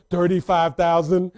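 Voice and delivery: put-on slow, loud bid in strong Haitian accent. Mom busts out a bid, strong Haitian accent. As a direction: imitate mom's heavy Haitian accent, drawn out. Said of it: "with her strong accent".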